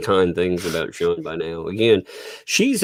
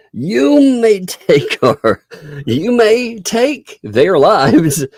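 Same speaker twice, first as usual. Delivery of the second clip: Braveheart speech; 11 semitones higher, 5 dB louder than usual. Pretend.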